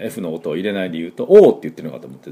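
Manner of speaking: usual speaking voice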